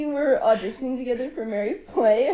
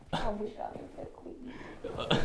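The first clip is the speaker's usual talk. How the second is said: whispered quote; 2 semitones lower, 10 dB quieter than usual. Retold or imitated. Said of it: "He's just like".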